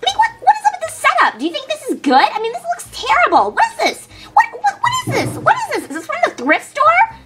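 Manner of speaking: high, fast and scornful; snooty diva. Acted. Brittany scoffs at the cheap set, high and snooty. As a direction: sneer at the cheap set like a spoiled star.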